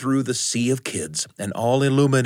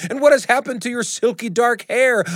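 terrified; 11 semitones higher than usual. Acted.

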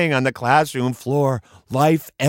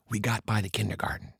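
ordinary delivery, nothing marked

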